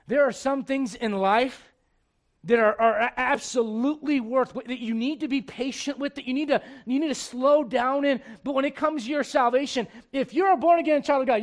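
loud, high, driving sermon voice; full-force preaching. Acted. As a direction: preach it out to the whole church. Preacher's closing appeal.

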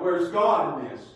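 a loud, slow cry to the sky; imitating Israel crying out. People of Israel crying out to God, mock anguish. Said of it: "They started crying out".

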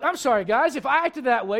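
ordinary delivery

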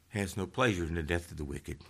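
quick, low and soft; quiet echo of a preached line; hushed repeat. Real, not acted. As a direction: drop down and say it softly. Repeats the shouted line softly.

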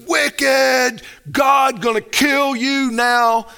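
high, drawn-out street-preacher holler; hammed-up cry of judgment on the wicked; mock condemning shout. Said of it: "you go out there and go".

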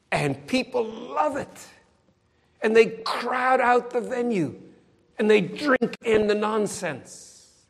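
impassioned preaching. Real, not acted. Preacher denouncing false prophets to the congregation, impassioned. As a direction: stretch the key words with rising indignation.

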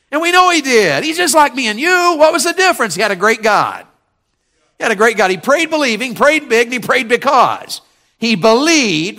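high, loud, rousing sermon; fired-up preaching. Real, not acted. Preacher tells church Elijah was like them, fired-up. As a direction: belt it out from the pulpit.

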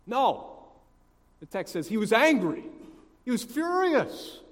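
loud, high-pitched dramatic emphasis; preacher's rising, forceful exclamation; emphatic outrage. A narrator's reading. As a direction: punch out the anger for the congregation. Preacher dramatizes the brother's anger.

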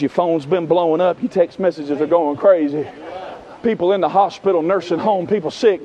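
soft, low, tender appeal; gentle invitation. Acted. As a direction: draw the weary listener aside quietly. Voices Jesus gently inviting the weary.

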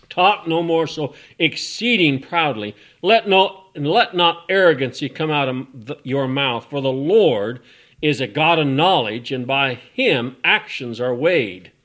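loud, ringing sermon delivery; full-voiced preacher; fiery preaching. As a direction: preach it out to the back row. Loud scripture reading.

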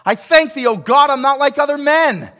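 loud, high, self-satisfied praying; mock the proud Pharisee lifting his voice; pompous Pharisee. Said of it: "They prayed to themselves".

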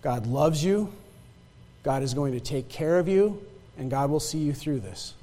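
soft, low, tender reassurance; gentle quoted blessing. Retold or imitated. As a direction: comfort a sick stranger. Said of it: "She goes".

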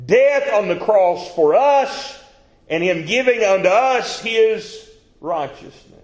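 high, loud, slow scripture reading; preacher reading Romans at full force; preaching scripture. Real, not acted. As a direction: proclaim the verse slowly. Preaching climax on faith.

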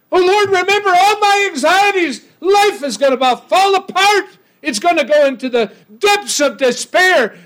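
high, loud cry of despair; preacher voicing the psalmist's desperate plea; anguished shouted prayer. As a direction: cry out like everything is falling apart.

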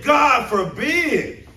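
God forbid shouted high and very slow; preacher's shout. Shown as a demonstration. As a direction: thunder God forbid at the congregation, stretching it out. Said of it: "that's what it's shouting at".

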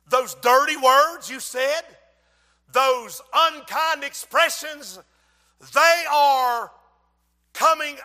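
loud, high, slow, hammered phrases; booming, emphatic sermon cadence; full-force preaching. Acted. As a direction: drive each phrase home from the pulpit.

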